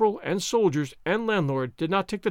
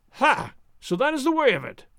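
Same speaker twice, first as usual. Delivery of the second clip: mocking cry; 8 semitones higher than usual. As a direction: crow over the discovery. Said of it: "Gonzales cried".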